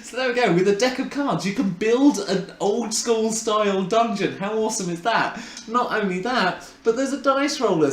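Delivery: high, fast, eager sales patter; excited pitch. Real, not acted. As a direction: sell it with real enthusiasm. Gushing product pitch to viewers.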